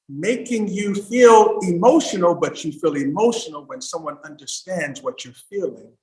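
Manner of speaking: ordinary talk, nothing marked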